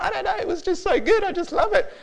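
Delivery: put-on very high, squeaky child voice; overcome kids. Children answering the preacher's interview, overcome and squeaky. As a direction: mimic a blissed-out, tearful little kid. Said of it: "They're like".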